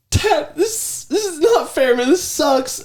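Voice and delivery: put-on high whiny kid complaint. Father imitates his son whining leaving the stadium. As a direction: whine it like a tearful boy.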